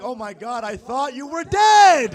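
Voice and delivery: imitating an overexcited friend, rising to a yell; loud astonished friend. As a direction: build to a drawn-out, loud last word. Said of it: "Then he makes it worse".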